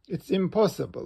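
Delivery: usual speaking voice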